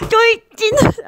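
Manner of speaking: very high, loud squeaky put-on voice; old impression. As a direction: squeal it out in a silly cartoonish voice. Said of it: "이 성대모사를 보면서". (아주 높고 과장된 성대모사 목소리)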